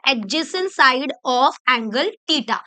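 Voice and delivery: very slow, measured repeat of the term; slow dictation. Shown as a demonstration. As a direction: give each word room for learners to follow. Answers own question for learners. (धीरे-धीरे, हर शब्द अलग करके)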